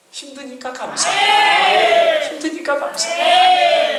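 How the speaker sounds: high, stretched-out sermon cry; impassioned preaching. Real, not acted. Preacher urges congregation to thank God in pain, impassioned preaching. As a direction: answer each protest with a ringing, drawn-out thanks.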